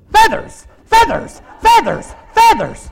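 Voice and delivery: high, loud yelps of one word; imitated frantic shout. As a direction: yell it like warding off a mugger. Woman shouting at a mugger.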